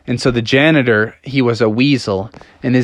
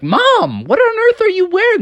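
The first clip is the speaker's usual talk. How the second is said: put-on high-pitched, incredulous kid voice; appalled young Froggy. Froggy questions his mom's outfit, high-pitched and appalled. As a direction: squeal it at an embarrassing mother. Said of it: "Froggy was like".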